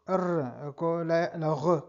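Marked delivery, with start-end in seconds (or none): none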